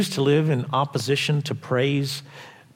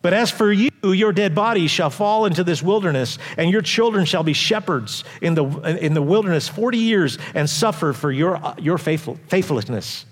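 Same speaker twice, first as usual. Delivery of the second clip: the Lord's warning read at full voice; scripture reading; 4 semitones higher than usual. Acted. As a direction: proclaim it from the pulpit with weight.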